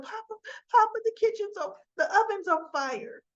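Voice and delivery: high, loud alarmed cry for Papa; panicked child calling. Retold or imitated. Girl yelling to her father that the oven's burning, high and panicked. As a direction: call out in a panic like a scared kid.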